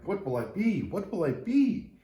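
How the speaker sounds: eager little tree bubbling over; excited. Acted. Tree wondering about its future, eagerly rising in excitement. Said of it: "The little tree was excited".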